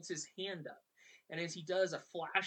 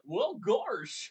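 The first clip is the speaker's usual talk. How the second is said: goofy drawl with a laugh; 5 semitones higher, 7 dB louder than usual. Retold or imitated. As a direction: play it like a dopey cartoon dog. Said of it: "Goofy's just like".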